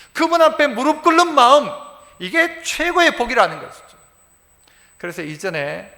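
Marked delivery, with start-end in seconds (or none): none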